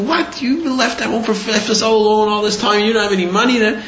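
high-pitched mock outraged wife; indignant wife imitation. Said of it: "My wife's gonna say".

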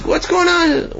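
high, outraged demand; indignant outcry. Acted. Tenants chasing the man, crying out in outrage. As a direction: cry it out at the culprit.

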